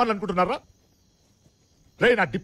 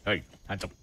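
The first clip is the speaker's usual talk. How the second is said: low, soft, barely enforced command; half-hearted order; 13 semitones lower, 8 dB quieter, much slower than usual. Acted. Officer first ordering a suspect to surrender, low and soft. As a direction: say it low, without force.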